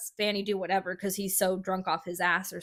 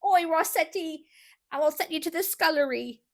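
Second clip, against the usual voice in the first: high-pitched mock Cockney scolding; [name]'s not very good Cockney; 7 semitones higher than usual. Host imitating a woman scolding Rossetti, Cockney accent. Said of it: "I can't do a very good Cockney".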